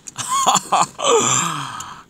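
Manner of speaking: high-pitched laughing outburst. Real, not acted. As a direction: laugh out high, then gasp. Reacts to the view, laughing.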